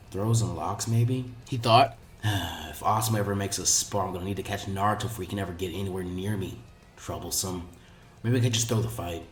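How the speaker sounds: low, quiet, bored inner mumble; a hint of a tired, unbothered teen; lazy Shikamaru thinking. A narrator's reading. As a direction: grumble it to yourself like it's all a drag.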